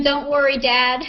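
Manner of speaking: normal talking voice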